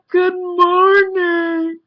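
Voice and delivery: very high, loud, drawn-out Good morning; loud obnoxious greeting. Retold or imitated. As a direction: bellow it slowly to annoy the room. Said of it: "I said it really loud and obnoxious".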